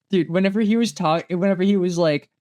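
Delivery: ordinary delivery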